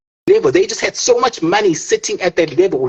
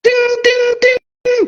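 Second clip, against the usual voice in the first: much higher mock bell chime; bell ding; much slower than usual. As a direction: sing a high ding-ding like a bell.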